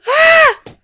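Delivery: shrill scream of fright; falling scream. Acted. Falls, screams.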